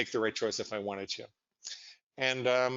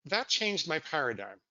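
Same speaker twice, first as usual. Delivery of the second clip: usual speaking voice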